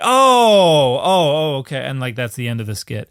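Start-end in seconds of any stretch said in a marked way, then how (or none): 0.0-1.8 long loud high "oh" settling down; mock chorus of sudden understanding; cast realizing. Imitating the skit's whole cast finally getting it, mock realization. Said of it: "they're all like".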